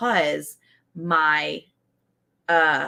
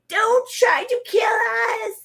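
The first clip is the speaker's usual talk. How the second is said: high, slow, wailing cry of tiny weeds begging; pleading weeds; 4 dB louder, much slower than usual. Acted. Gardener voicing her weeds begging not to be killed, high wailing plea. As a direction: wail it out as the weeds begging for mercy. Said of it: "and they're like".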